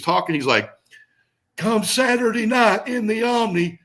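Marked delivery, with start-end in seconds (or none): 1.6-3.8 high, slow, carefully careful promo voice, imitating Steve; forced non-Southern promo. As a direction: mimic Steve straining to hide his Southern accent, slowly and high. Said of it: "trying not to sound Southern".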